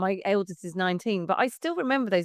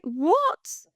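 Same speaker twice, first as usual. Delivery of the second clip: incredulous; 9 semitones higher than usual. Retold or imitated. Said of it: "I was like".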